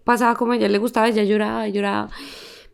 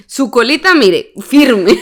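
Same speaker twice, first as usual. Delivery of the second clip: cheeky boss praise; 2 semitones higher, 9 dB louder, slower than usual. Retold or imitated. Mimicking boss's cheeky praise.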